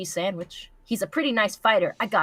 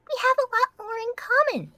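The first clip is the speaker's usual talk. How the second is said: pony voice. Acted. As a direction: whine it like a cartoon pony. Voicing character in fanfic reading.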